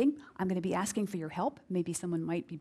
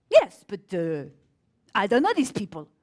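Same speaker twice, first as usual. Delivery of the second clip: French accent imitation; 9 dB louder than usual. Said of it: "he had a very thick accent".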